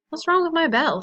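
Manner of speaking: ordinary delivery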